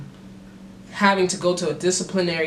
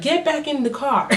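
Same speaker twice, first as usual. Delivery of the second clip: higher, sharp order; cop command; 9 semitones higher than usual. Retold or imitated. Police officer ordering him back into the car, sharp. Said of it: "she's like".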